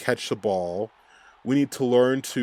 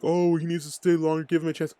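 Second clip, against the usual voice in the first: higher, mocking sing-song; mocking naive fans; 7 semitones higher than usual. Mocking fans who want the coach kept, higher. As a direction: parody the fans who beg for patience. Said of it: "there's some people like".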